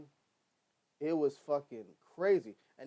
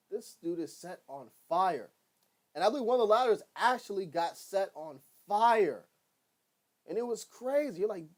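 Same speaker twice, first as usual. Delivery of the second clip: ordinary talk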